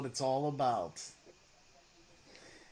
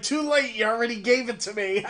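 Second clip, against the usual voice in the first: higher, louder, fast and laughing; laughing retort; 7 semitones higher, 7 dB louder, faster than usual. Pretend. Host answering viewers who want their view back, laughing fast retort. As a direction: toss it back mid-laugh.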